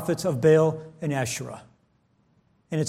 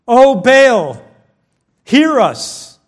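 loud, raised plea to a deity; calling on a god; 4 semitones higher, 6 dB louder, much slower than usual. Acted. Prophets crying out to Baal, calling on their god. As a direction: cry out to a god for an answer.

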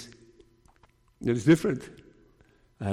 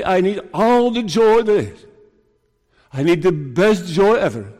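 higher and louder, a hungry plea; eager longing cry; 5 semitones higher, 4 dB louder than usual. Pretend. Preacher voicing the believer's plea, eager and loud. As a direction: cry out for all the joy.

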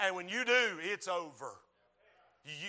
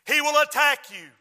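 loud, raised warning; full-force preaching; 7 semitones higher, 12 dB louder than usual. Real, not acted. Preacher warning believers of the devil, full-force preaching. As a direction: hit the warning hard.

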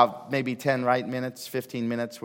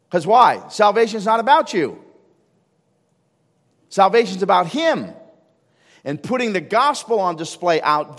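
louder, higher, rousing preacher's call; preaching at full force; 4 semitones higher, 5 dB louder than usual. Acted. Pastor urging his church to go out, rising and loud. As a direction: drive the point home to the pews.

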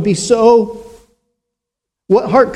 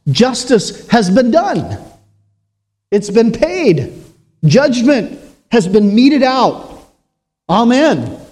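higher, triumphant declaration; voice of the blood; 4 semitones higher than usual. Acted. Preacher voicing Christ's blood from the cross, triumphant. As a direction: proclaim the debt as settled. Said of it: "This blood cries out".

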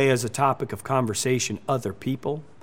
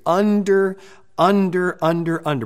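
"under" hammered louder and higher each time; insistent repetition; 4 semitones higher, 4 dB louder than usual. Acted. Preacher driving home "under" to his congregation, insistent. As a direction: pound the word "under" home. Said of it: "under the power of the Holy Spirit".

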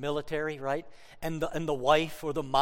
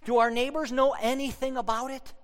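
excited questions; 8 semitones higher, 4 dB louder than usual. Retold or imitated. A new mother bursting to share the news, excited. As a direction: voice lifted high and louder with eager questions.

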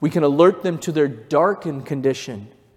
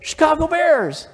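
imitating a stranger's happy call of "Chicago Bears!", much higher; excited call-out; 12 semitones higher, 4 dB louder than usual. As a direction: call out "Chicago Bears!" like a fan spotting a shirt. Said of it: "he goes".

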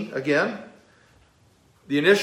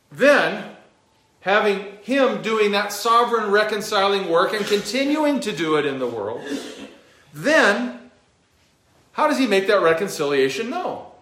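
building question; 5 semitones higher, 3 dB louder than usual. Real, not acted. Rising to a rhetorical question.

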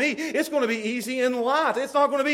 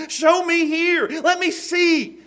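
mock challenge; 8 semitones higher, 5 dB louder, much slower than usual. Jesus challenging the critics.

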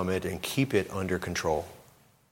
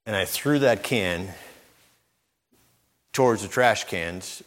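ordinary delivery

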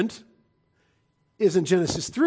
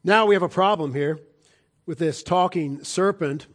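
ordinary talk

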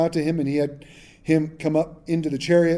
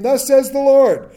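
slow, loud, raised proclamation; the prophet declaring the Lord's verdict to the king, slow and loud; prophetic proclamation; 9 semitones higher, 8 dB louder, slower than usual. Retold or imitated. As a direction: announce it like a prophet thundering doom.

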